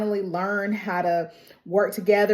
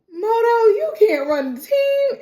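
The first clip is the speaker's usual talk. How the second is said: whiny protest; 12 semitones higher, 3 dB louder than usual. Retold or imitated. Teammates protest Moto owning team.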